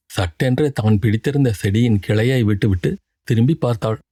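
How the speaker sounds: usual speaking voice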